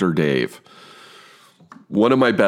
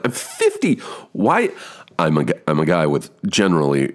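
ordinary talk, nothing marked

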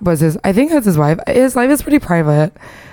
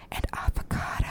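whispering; 17 dB quieter than usual. Pretend. Listing an arousing sandwich.

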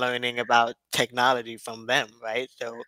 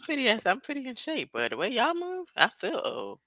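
mock protest; 10 semitones higher, 4 dB quieter than usual. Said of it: "and I'm like".

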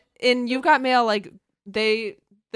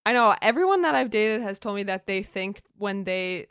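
ordinary delivery

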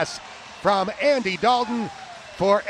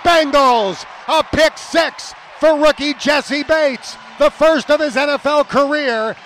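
high, loud, triumphant announcer call; excited touchdown shout; 6 semitones higher, 6 dB louder than usual. Real, not acted. Announcer calling a rookie's pick six, excited shout. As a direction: belt it out, stretching the big words.

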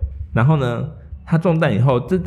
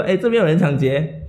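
mock alarm call, pitched higher; retold yell; 7 semitones higher than usual. Bystander calling passers-by about the robbery, a mock shout. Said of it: "所以他就大喊说".

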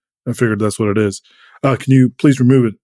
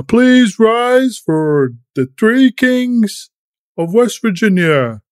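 town crier; 8 semitones higher, much slower than usual. Acted. Herald announces the kings.

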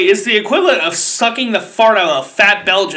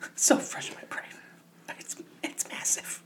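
whispered laughing; 17 dB quieter than usual. Real, not acted. Laughing while trying to tell story.

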